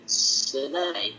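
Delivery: ordinary talk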